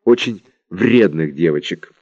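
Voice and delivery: usual speaking voice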